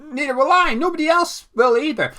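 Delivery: put-on high, loud mess of a Cockney accent; badly faked Cockney, loud and silly; bungled Cockney impression. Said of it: "what that accent or impression was".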